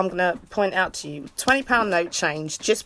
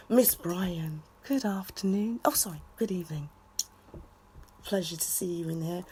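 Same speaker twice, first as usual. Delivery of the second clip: much softer, low whispered welcome; hushed greeting; 4 semitones lower, 11 dB quieter than usual. Real, not acted. Streamer greets a viewer in a hush. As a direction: whisper a hello to her.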